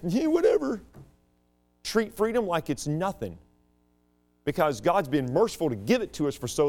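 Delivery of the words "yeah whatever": high, careless mocking shrug; dismissive mock. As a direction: toss it off like a bored teenager. Mocking careless attitude toward freedom.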